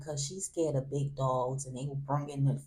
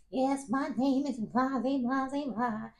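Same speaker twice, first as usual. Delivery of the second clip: Facebook Live friend; 8 semitones higher than usual. Retold or imitated. Friend broadcasting the traffic stop to viewers, hammed-up. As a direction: narrate the stop for the camera. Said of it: "He like".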